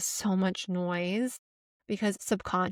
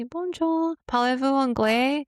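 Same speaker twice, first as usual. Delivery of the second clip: bright higher French, politely asking; polite French greeting; 5 semitones higher, 3 dB louder than usual. Retold or imitated. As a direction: greet them sweetly in French. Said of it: "you go up to the French and you say".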